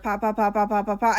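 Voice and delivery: quick burst of punched pops; imitated gunshots. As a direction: rattle off the pops like gunfire.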